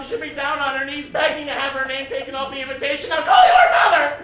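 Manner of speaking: rant, then bellow the final order; exasperated fiancée at full volume; furious fiancée. Acted. Fiancée shouts at Ivan.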